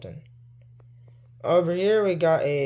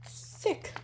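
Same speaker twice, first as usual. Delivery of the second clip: breathy whisper of the word; 9 dB quieter than usual. Real, not acted. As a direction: whisper it to yourself. Repeats it under breath.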